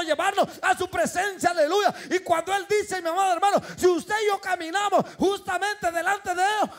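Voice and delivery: loud, high fervent preaching; full-force preaching. Acted.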